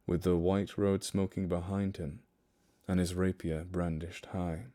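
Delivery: hushed storyteller reading a ballad; poem recital. Acted. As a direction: read it like a haunting ballad. Reads The Highwayman poem aloud.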